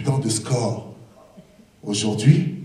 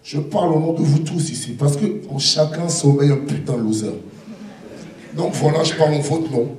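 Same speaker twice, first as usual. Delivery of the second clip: fast; faster than usual. Real, not acted. Rushed aside.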